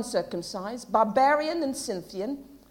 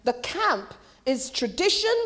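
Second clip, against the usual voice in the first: ordinary talk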